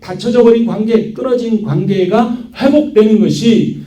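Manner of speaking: high-pitched, driving sermon delivery; impassioned preaching. Real, not acted. Pastor confessing himself a sinner before God, impassioned preaching. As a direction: proclaim it with sustained high intensity.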